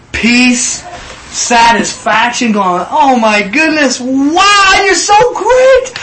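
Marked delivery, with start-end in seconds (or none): 2.9-5.9 rising high, slow and loud in delight; overjoyed exclaim. Acted. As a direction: gush with amazed joy. Elijah overflowing with joy.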